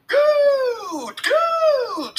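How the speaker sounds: very high, loud, drawn-out screech. A narrator's reading. Billy hopping and drooling, screeching wildly. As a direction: screech each word like a crazed creature. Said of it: "screeched Billy".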